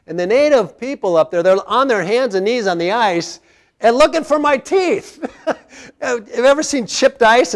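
fired-up preaching. Acted. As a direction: preach it with full force, hands up. Preaching at full force about lost teeth.

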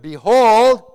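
"behold" called out loud and rising; a loud summons on "behold"; listen-up behold. Shown as a demonstration. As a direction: ring out "behold" so they sit up. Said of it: "that means listen up".

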